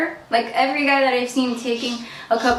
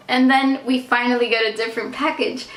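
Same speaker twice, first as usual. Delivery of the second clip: normal talking voice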